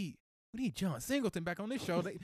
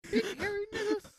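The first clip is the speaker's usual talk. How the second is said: high screechy cartoon shriek; Mister Crocker voice. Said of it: "the Mister Crocker way".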